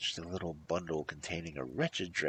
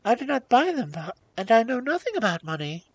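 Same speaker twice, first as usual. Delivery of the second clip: high, raised princess voice; helpless princess; 12 dB louder than usual. A narrator's reading. Princess questioned on the cost of clothes, helpless. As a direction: protest innocence, pitched way up.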